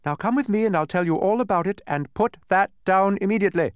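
high, loud reprimand; stern scolding. A narrator's reading. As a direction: scold a noisy youngster and order him about. Startled keeper scolds the boy.

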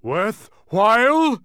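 very loud, high, drawn-out outburst; indignant roar. A narrator's reading. The man throwing Milo's word back, indignant roar. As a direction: roar it back in outraged disbelief. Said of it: "the man roared indignantly".

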